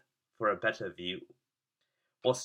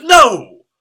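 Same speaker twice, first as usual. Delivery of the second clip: dramatic exclamation; 10 semitones higher, 16 dB louder than usual. A narrator's reading. Narrator's dramatic twist.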